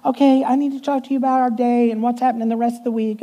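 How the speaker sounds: high, quick sweet-lady voice; imitating the secretary. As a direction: rattle it off like an eager sweet secretary. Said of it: "she'd go".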